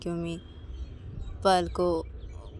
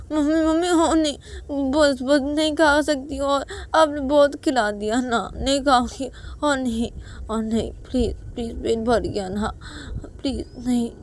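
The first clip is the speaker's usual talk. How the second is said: put-on pleading child; 8 semitones higher, 6 dB louder than usual. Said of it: "पायल खाना खाते बोलती कि".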